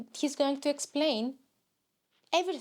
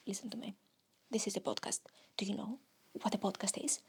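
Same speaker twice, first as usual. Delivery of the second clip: hushed unvoiced whisper, lower; 4 semitones lower, 8 dB quieter, faster than usual. Host whispering to a puzzled guest, unvoiced whisper. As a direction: lean in and whisper the secret. Whispered aside to the other persona.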